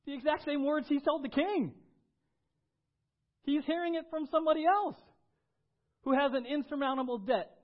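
high, loud, driving preaching; full-force preaching. Acted. Pastor explaining the servant's plea, loud preaching. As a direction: press the point hard on the crowd.

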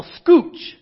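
high, loud shouted scooch; yelled command. Retold or imitated. Guide yelling at stuck students, loud shout. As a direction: bark it out to the raft. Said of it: "they would yell".

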